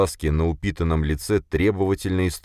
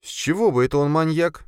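much higher puzzled female-character voice; bewildered; 12 semitones higher than usual. A narrator's reading. Sofia questions boss's verdict, bewildered. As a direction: ask high, genuinely not understanding. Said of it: "недоуменно посмотрела на него Софья".

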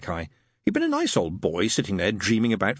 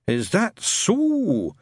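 sceptical drawl; 10 semitones higher, much slower than usual. Acted. Shadwell sceptical.

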